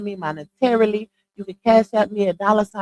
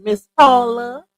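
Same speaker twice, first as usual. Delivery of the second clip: normal talking voice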